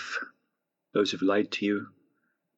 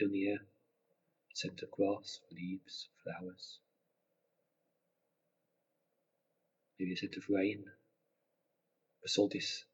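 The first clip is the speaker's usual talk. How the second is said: guided meditation; 4 dB quieter than usual. Acted. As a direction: gently guide them into relaxation.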